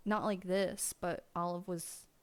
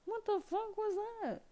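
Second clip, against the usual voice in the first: alarmed pet talk; 11 semitones higher than usual. Real, not acted. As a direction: squeak it out in shocked concern. High voice to scared cat.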